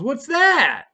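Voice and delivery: high, loud startled cop question; officer's sharp question. Retold or imitated. Officer confronting driver with a loud, high question. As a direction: bark it like a suspicious cop.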